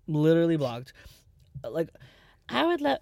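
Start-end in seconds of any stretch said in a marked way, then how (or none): 2.5-3.0 boss babe. Retold or imitated. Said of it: "it's like the boss babes".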